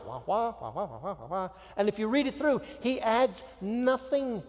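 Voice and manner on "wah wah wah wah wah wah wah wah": mocking wah-wah drone; a playful wah-wah noise; meaningless noise. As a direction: mimic empty chatter like a muted trumpet. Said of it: "it's just like noise".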